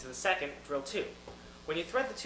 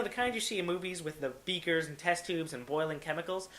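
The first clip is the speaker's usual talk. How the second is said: ordinary talk, nothing marked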